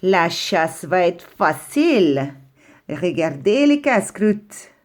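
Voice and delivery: slow, sly, relishing fox voice; hungry fox gloating over two fighting rabbits, slow and sly. Acted. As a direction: savour the sight of two easy snacks. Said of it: "un renard affamé survint".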